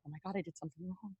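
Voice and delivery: very quiet, quick acted self-reproach; retold tearful dismay. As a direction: murmur it fast, imitating a crushed child. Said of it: "be in tears".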